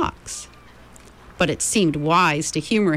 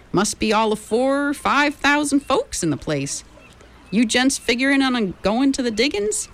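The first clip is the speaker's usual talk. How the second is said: put-on old miner voice; 7 semitones higher than usual. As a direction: play a chatty gold-rush miner.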